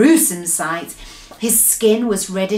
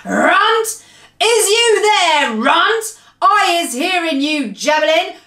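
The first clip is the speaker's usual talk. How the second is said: high, loud giant's bellow; storybook giant roaring for someone; thunderous shout; 9 semitones higher, 4 dB louder, slower than usual. A narrator's reading. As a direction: call out slowly in a booming giant voice. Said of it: "a voice like thunder shouted".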